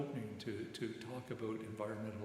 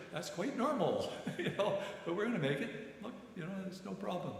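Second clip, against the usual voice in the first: higher, laughing through a reassuring reply; laughing reassurance; 8 semitones higher, 3 dB louder than usual. Retold or imitated. Chaplain laughing as he reassures the nervous medic in the boat. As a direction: chuckle it off to calm him down.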